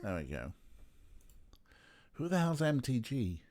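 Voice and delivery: ordinary delivery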